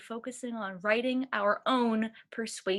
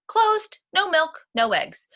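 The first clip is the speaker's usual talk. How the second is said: higher and louder, cheeky cow voice; cows' cheeky note; 5 semitones higher, 6 dB louder than usual. A narrator's reading. Cows posting a strike sign for Farmer Brown, perky and loud. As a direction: announce the strike sign brightly.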